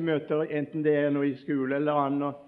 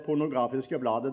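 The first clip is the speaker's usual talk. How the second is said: ordinary talk, nothing marked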